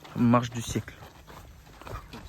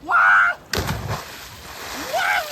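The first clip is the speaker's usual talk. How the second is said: shocked shout; 5 dB louder than usual. Real, not acted. A friend jumps into the sea.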